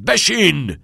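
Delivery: loud, high, sharp command; shouted order. A narrator's reading. Nolan advances on Todd, shouted order. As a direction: yell it at a pupil. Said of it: "فریاد زد".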